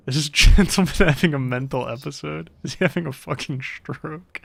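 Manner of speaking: loud laughing disbelief; cracking up mid-question; real laughter breaking through. Real, not acted. Cracking up at NPC.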